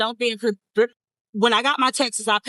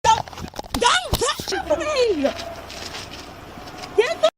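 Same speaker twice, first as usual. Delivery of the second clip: high screaming protest; shouting match; 12 semitones higher, slower than usual. Real, not acted. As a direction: scream it, much higher. Resisting arrest, yelling.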